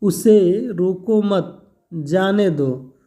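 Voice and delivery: slow "उसे रोको मत", break, then "जाने दो"; group "रोको मत" together, let "जाने दो" fall separately; pause after मत. Shown as a demonstration. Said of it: "सिर्फ एक कामा के प्रयोग से".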